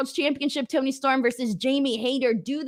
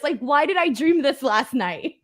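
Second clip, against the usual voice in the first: usual speaking voice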